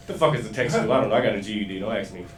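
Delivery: ordinary delivery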